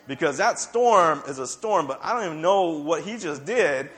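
ordinary talk, nothing marked

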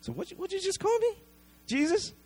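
high, fast, outraged woman's voice; indignant shock. Retold or imitated. Woman offended at being called dog.

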